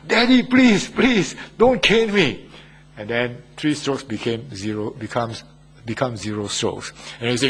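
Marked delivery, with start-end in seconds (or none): 0.0-2.5 high, loud imitation of a desperate child; acted wail of a child begging a parent; crying child pleading. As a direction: beg hard, drawing out the plea. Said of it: "the child cries and said".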